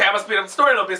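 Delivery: ordinary delivery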